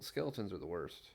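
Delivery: ordinary delivery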